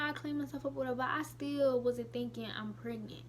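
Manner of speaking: ordinary talk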